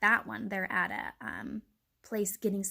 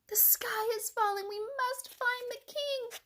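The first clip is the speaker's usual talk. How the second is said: squawking and clucking; 13 semitones higher than usual. A narrator's reading. Chick and hen running to the pond, squawking. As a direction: squawk the warning in a high bird voice. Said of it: "squawking and clucking".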